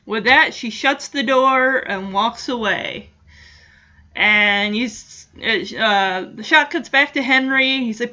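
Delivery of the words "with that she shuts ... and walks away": ordinary talk, nothing marked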